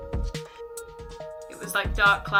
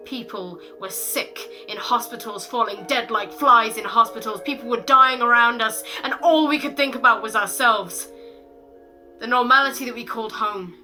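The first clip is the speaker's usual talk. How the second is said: loud, raised-pitch poetic outburst; impassioned spoken word; 3 semitones higher, 7 dB louder than usual. Acted. Poet tells of people dying all around, impassioned loud recitation. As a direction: build to a forceful, driving cry of outrage. Poem erupts over the dying.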